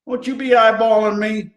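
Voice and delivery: put-on light country rodeo accent. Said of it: "I got this accent going".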